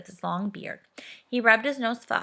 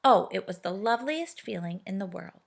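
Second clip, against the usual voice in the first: ordinary delivery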